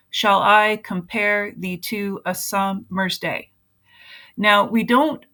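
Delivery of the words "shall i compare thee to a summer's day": slow, syllable-by-syllable beat, weak then strong: "Shall I compare thee to a summer's day?"; measured da-DUM meter on "Shall I compare thee to a summer's day?", stressing every second syllable; iambic stress. Shown as a demonstration. Teacher showing how to break down a poem, slow alternating stress on "Shall I compare thee to a summer's day?". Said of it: "the unstressed and then the stressed syllable".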